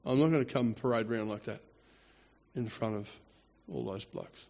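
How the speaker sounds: low, quiet, dismissive refusal; queen's refusal. Retold or imitated. Queen refuses to parade before the men, quietly dismissive. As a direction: refuse under your breath, unbudging. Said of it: "being told by the queen".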